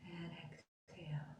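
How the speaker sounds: very soft, low whisper; whispered exhale cue. Real, not acted. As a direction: let the words sink away on the breath. Breathing out during guided meditation.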